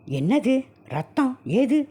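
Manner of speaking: high, slow shocked question; alarmed. Acted. Husband finds fresh blood on his body, high alarmed. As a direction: stare at the blood in disbelief.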